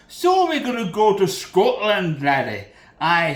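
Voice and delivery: loud, put-on Scottish lilt, falling on "laddie"; hammed-up Scottish burr; Scottish accent. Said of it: "I like the Scottish accent".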